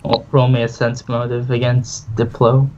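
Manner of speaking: ordinary talk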